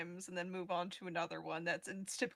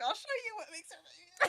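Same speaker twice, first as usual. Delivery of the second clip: laughing retort. Retold or imitated. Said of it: "And I'd be like".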